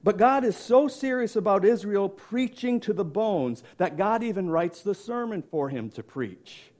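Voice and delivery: ordinary delivery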